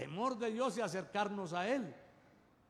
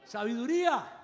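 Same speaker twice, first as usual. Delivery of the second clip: louder proclaimed sabiduría; proclaimed answer; 3 semitones higher, 8 dB louder than usual. Real, not acted. Preacher answers his own question to the congregation, louder. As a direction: ring out Sabiduría with force. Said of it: "Qué pidió Salomón".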